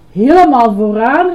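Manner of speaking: loud, rising cry of disbelief; exclaimed question. A narrator's reading. Girl hears he must restart, exclaimed question. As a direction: cry it out in dismay. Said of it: "riep Betty uit".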